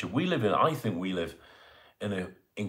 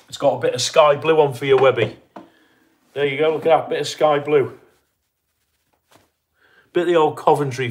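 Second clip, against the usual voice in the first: loud showing off; 4 semitones higher, 8 dB louder than usual. Real, not acted. Showing off shirt to viewer.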